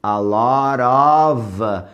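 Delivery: "A lot of" very slow, of ending in V; slow model phrase. Shown as a demonstration. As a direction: stretch "A lot of", V sound at the end. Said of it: "preposição of não é som de F". ("A lot of" bem devagar, of com som de V)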